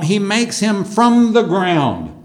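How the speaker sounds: ordinary talk, nothing marked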